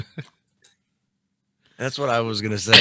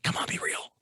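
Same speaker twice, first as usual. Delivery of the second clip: whisper; 11 dB quieter than usual. Real, not acted. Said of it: "whispered hope".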